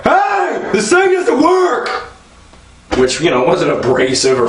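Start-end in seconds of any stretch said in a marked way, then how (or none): 0.0-1.9 much higher, slow, whiny complaint; high whiny shout. Retold or imitated. Comic retelling a self-checkout customer's squeal. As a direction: squeal it like an offended shopper. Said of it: "He was more like".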